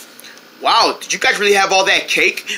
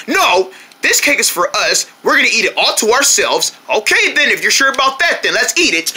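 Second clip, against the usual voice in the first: normal talking voice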